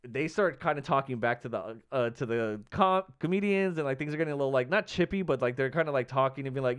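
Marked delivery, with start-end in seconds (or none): none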